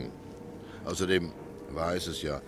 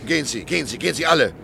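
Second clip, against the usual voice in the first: growing shout; 8 semitones higher, 5 dB louder than usual. Acted. Owner drives the crowd out.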